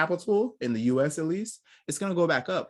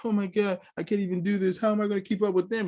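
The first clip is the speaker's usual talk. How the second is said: higher, whining self-pity, a bit fast at the end; whiny recruit; 7 semitones higher than usual. Retold or imitated. Mocking a recruit who gives up, higher whining. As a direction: play a defeated recruit moaning that he can't keep up.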